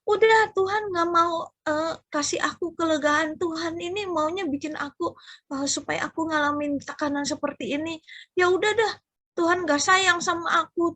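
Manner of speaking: mock-whiny son voice; sulking son. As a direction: imitate a young man grumbling at God.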